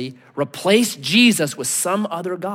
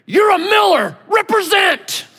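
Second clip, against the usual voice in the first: high, loud exasperated shout; dad losing it; 12 semitones higher, 4 dB louder than usual. Retold or imitated. Dad to crying son on diving board, imitated furious shouting. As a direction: yell it like a father who has snapped, pitched way up. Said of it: "then the dad just lost it".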